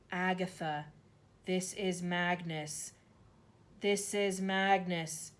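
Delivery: slow, solemn ghost voice; ghostly message. A narrator's reading. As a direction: spell it out from beyond. Ghostly words written by chalk.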